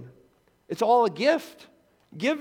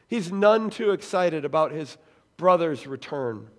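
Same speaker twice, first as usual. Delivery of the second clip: ordinary talk, nothing marked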